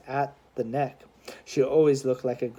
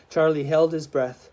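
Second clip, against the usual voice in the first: usual speaking voice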